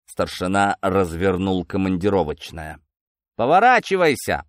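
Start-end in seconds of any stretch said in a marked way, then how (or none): 3.4-4.4 high, barked sergeant's order; raised, nasty command; angry command. Acted. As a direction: snap an order at a prisoner. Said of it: "зло скомандовал сержант".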